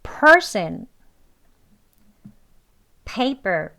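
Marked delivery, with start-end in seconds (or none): 0.0-0.9 person with per said higher and louder; stress on per. Shown as a demonstration. Teacher repeats person with a stressed first syllable. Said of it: "앞에 음절을 강하게".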